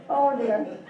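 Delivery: ordinary talk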